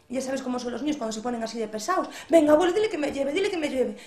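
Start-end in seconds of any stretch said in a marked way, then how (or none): 2.3-4.0 high, loud insistent pleading; loud childish whining; whining little boy. A narrator's reading. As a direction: nag grandpa like a stubborn kid.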